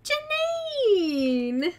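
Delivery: high loud name call; excited call. Real, not acted. Announcing the giveaway winner to viewers, calling out loudly.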